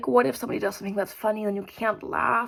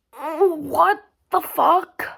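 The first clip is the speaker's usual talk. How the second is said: high, loud, slow stretched outcry; drawn-out disbelief; 6 semitones higher, 6 dB louder, much slower than usual. Real, not acted. Reacting to the museum coming alive, a loud drawn-out outcry.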